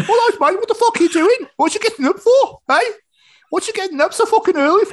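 very high, loud Brummie-Yorkshire indignation; muddled Brummie-Yorkshire accent. Acted. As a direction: berate him in a botched Brummie-Yorkshire voice. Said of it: "That was like a Brummie mix of a Yorkshireman".

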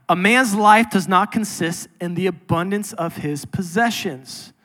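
usual speaking voice

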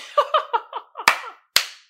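loud high cackling laugh; high laugh burst. Real, not acted. Cracking up again.

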